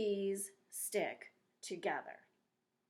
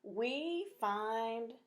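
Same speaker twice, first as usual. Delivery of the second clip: reading out what I wrote; 3 semitones higher, 4 dB louder, much slower than usual. Shown as a demonstration. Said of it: "And I wrote".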